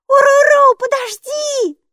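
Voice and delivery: very high, loud cry; squealed, panicky plea; gasping alarm. A narrator's reading. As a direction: call out in dismay, dragging the last word. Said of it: "ахнула Майки".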